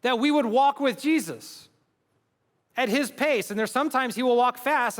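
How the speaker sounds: rising sermon fervor; preaching at full force. Acted. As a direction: drive the point home to the crowd. Preaching climax.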